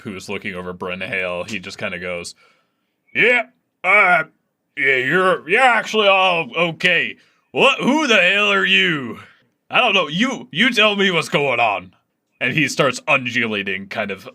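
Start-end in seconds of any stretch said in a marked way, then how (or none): 3.1-11.9 higher and louder, blustery blob; ooze creature voice. Acted. The ooze thing demands answers, loud and higher. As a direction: bark like a confused, irritated slime.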